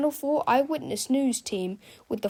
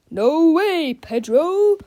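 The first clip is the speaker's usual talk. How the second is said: higher, louder heroic cry; cried out; 6 semitones higher, 9 dB louder, much slower than usual. A narrator's reading. Hero defying Melvin, loud cry. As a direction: fling the refusal back proudly. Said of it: "cried Captain Underpants".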